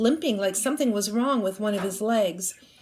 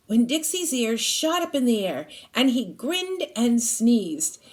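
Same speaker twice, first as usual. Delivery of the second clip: ordinary delivery, nothing marked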